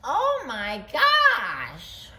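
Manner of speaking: loud, high, drawn-out exclamation; huge surprised shriek, stretched out; hammed-up storybook astonishment; crying out. A narrator's reading. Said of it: "and she cried".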